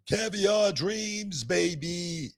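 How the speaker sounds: high, very slow showman drawl; catchphrase sign-off. Real, not acted. Host signing off the show with his catchphrase, showman style. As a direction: stretch it like a wrestling promo. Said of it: "signature sign-off catchphrase".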